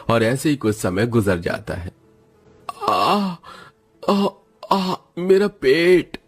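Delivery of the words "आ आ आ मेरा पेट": drawn-out, high cries of stomach pain; moaning in agony over a stomach ache.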